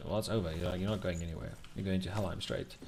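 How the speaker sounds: normal talking voice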